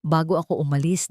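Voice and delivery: ordinary delivery, nothing marked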